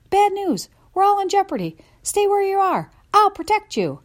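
very high, loud panic; frantic shouting. Acted. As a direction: yell it in a frantic rush. Dog frantically warns family.

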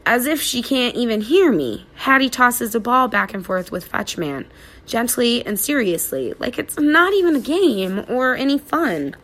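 plain reading voice

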